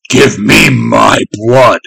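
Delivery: slow, very loud monster scream; enraged creature's drawn-out roar. A narrator's reading. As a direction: bellow it, stretching every word. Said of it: "screamed the thing".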